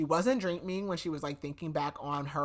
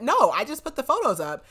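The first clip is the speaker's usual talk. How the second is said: higher, louder mock protest; defensive retold denial; 8 semitones higher, 8 dB louder than usual. Gunn answering her murder accusation, defensively protesting.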